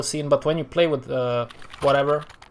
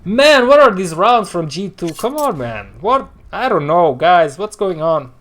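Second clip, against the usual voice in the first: louder, higher exasperated complaint; exasperated outburst; 4 semitones higher, 7 dB louder than usual. Real, not acted. Streamer to viewers about G two's rounds, exasperated. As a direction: burst out in real disbelief.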